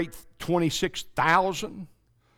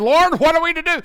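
pleading cry; 12 semitones higher, 8 dB louder, faster than usual. Acted. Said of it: "they cried, and they said".

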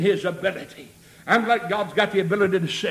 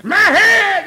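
high, loud, drawn-out preacher's cry; retold cry; 10 semitones higher, 9 dB louder, slower than usual. Preacher voicing a boy crying to be helped, loud cry. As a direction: cry out slowly as the hurt boy. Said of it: "And he cried".

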